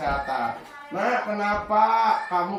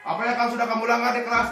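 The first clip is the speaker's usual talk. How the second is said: rapid questioning; 7 semitones higher, 4 dB louder, much faster than usual. Acted. Teacher questions pupil.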